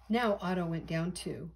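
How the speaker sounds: plain reading voice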